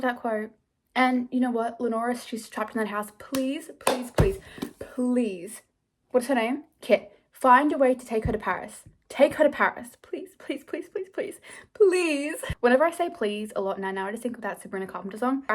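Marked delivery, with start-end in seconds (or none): 3.2-5.6 mild begging, each please set apart; plead, drawing out the last word. Real, not acted. Reader begging for a book character's escape, pleading. 7.3-12.4 high, insistent pleading bursting out loud at the end; urgent begging. Real, not acted. Reader urging Kit to take her to Paris, begging.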